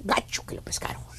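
low, soft whisper; hushed commiseration. Acted. Telling friends he was caught, whispered. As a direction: whisper it as shameful gossip.

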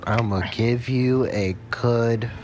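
drawn out word by word, very slow; slow, deliberate echo of a quote; incredulous repeat. Retold or imitated. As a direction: mockingly savour each word, slowly. Repeats the woman's line in disbelief.

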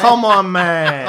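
loud, high protest; exasperated. Acted. Dragon argues with the party, loudly exasperated.

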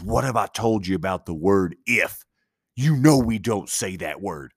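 imitating a stern mother's scolding; mock parental telling-off, suddenly serious; snapped, very serious. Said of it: "She snapped into very serious mode".

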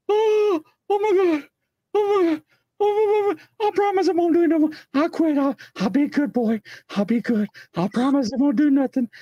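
high-pitched mock pleading; hammed-up, rising panic; panicked begging. Panhandler begs on seeing state line.

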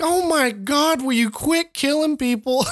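high, whiny mock exasperation; exasperated whine. Co-host mimics a movie character, high exasperated whine. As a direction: pitch it way up, fed up with the killing. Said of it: "And Don's like".

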